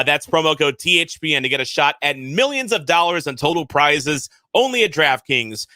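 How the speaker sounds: sponsor tag with a fast disclaimer; closing ad read. Acted. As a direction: rattle off the fine print. Host repeats promo code.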